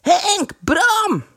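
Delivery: high, drawn-out distant call; high-pitched hollering across a distance; shouting. A narrator's reading. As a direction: shout the names as far as they'll carry. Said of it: "schreeuwde zo hard hij kon".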